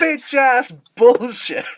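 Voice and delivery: drawn-out, high, loud exclamation; mock outrage. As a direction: stretch it out in hammed-up outrage. Acting out a mock outraged catchphrase.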